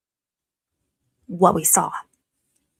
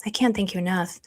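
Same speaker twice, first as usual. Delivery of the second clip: ordinary delivery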